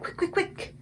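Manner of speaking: high, voiceless hurried whisper; the BFG rushing Sophie for a jar in a whisper; urgent whisper. Acted. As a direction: hiss the hurry under your breath.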